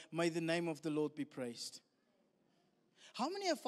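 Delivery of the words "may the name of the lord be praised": low, hushed and solemn; Job's bowed-down praise; reverent acceptance. Acted. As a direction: say it as quiet surrender to God. Job's reverent words after his loss.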